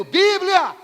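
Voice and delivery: sudden loud shout of Bíblia; loud preacher interjection; shouted aside. Real, not acted. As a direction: bark out the word Bíblia. Shouted aside mid-reading.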